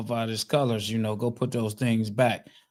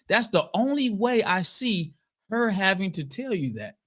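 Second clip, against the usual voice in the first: usual speaking voice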